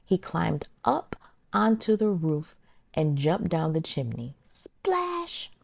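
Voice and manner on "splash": high, soft splash effect; splash sound. A narrator's reading. Narrator making the splash as wolf hits soup. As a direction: pop it out like a splash.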